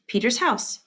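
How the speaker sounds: normal talking voice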